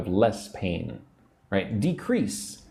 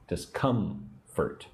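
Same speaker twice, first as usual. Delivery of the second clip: Discomfort slow and softer, split in syllables; 5 dB quieter, much slower than usual. Shown as a demonstration. Teacher drilling emphasis on syllable two, Discomfort slowly split. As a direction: break Discomfort slowly apart, leaning on COM. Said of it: "Put the emphasis on syllable two".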